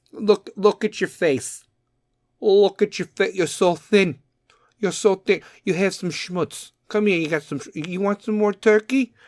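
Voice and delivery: a hint of a doting mother pressing food on you; pushy Italian mom. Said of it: "a fucking Italian mom".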